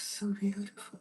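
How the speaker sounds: high, soft fairy voice; Erica's fairy voice. Acted. Artist showing how her character Erica talks, high fairy voice. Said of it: "Erica talks like this".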